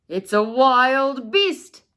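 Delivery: slow, loud mock growl. Papa bear spots Goldilocks, a loud slow mock growl. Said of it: "Papa Bear growled".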